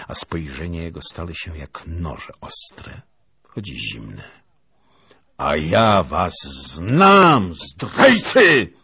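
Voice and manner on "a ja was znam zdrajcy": slow, loud booming accusation; thundering roar. Acted. Lieutenant roars at the princess's family, thundering. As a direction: thunder out the word traitors. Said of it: "huknął".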